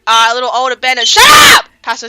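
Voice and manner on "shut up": high, loud snap at someone; real shout. Real, not acted. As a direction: yell it off-mic. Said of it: "shouts at someone in the room".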